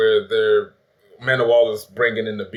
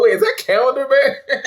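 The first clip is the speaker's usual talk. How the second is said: very high, loud amazed question; laughing disbelief; 5 dB louder than usual. Retold or imitated. Fan recognizing an obscure villain, retold laughing astonishment. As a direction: squeal it through laughter, amazed.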